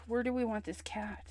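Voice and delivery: hushed question; whisper. Real, not acted. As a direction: whisper it to yourself. Whispered aside.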